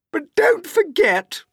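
high, loud, sneering aunt; Aunt Spiker crying out. Acted. Aunt Spiker mocking her sister, high and loud. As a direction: cut in with a shrill jab. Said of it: "Aunt Spiker cried".

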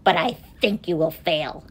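mildly taunting bird; sneering. A narrator's reading. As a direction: doubt him with a sneer. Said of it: "sneered the bird".

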